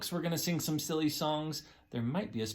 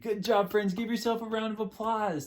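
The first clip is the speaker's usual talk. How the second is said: kindergarten teacher voice; 6 semitones higher than usual. Acted. Teacher praising kids.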